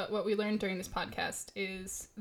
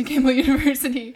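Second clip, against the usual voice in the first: laughing; 3 semitones higher, 13 dB louder, faster than usual. Real, not acted. Laughing while naming the university.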